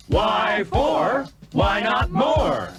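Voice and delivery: plain reading voice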